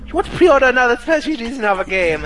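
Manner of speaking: high, fast, pushy salesman voice; mock the eager clerk upselling you; mock shop clerk.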